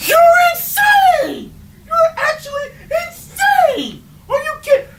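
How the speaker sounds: very high, half-crying comic shriek; hysterical mock scream. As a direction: shriek it like you are losing it. Co-host screams at the host.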